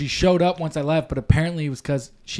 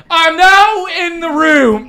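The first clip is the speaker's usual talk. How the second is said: high, loud, slow announcing call; mock announcement; 9 dB louder, slower than usual. Grandson jokes about announcing himself, loud and slow. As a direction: herald your entry, slow and loud. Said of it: "I do that from now on".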